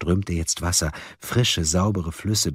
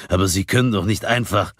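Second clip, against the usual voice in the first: quick, urgent mild protest; calling out; faster than usual. A narrator's reading. One of the four protests to the caller, calling out. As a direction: burst in to object. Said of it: "rief Grung".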